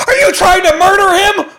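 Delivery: put-on very high, loud shocked child voice; shocked kid. Brother in the back seat, shrieking in shock. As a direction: squeal it like a horrified little brother. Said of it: "And Carson's in the back seat".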